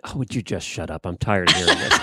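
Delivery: low grumbling horse voice; fed-up horse. Pretend. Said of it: "your horse ever just is thinking".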